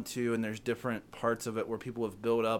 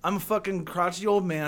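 worked-up grumpy rant, higher and louder; mock angry rant; 5 semitones higher, 5 dB louder than usual. Real, not acted. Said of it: "You're so mad about these Oreo flavors".

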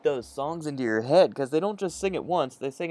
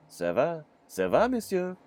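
mock French; 5 semitones higher than usual. Playing French grandpa.

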